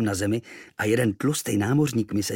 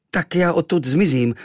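ordinary delivery

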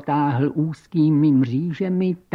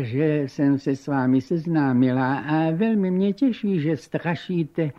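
gracious countess; 3 semitones higher than usual. Acted. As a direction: welcome your guest with aristocratic charm.

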